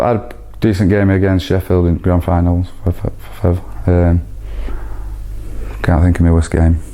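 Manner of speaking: ordinary talk, nothing marked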